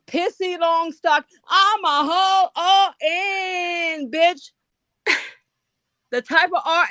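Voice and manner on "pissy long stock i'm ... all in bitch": mocking taunt. Real, not acted. Taunting rival nurse on livestream.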